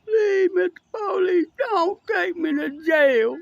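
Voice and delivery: high, sobbing begging, slow at the end; hammed-up blubbering plea to the cop; mock sobbing plea. Mocking the arrested man to the viewers: high fake sob. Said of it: "he crying".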